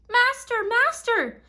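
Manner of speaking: very high, loud shriek; magic harp shrieking to wake the giant. A narrator's reading. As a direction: cry out for the master in alarm. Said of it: "but it shrieked".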